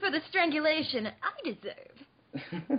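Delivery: slow, high theatrical lament; melodramatic. Pretend. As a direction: ham up the tragic martyr. Melodramatic self-pity over choking.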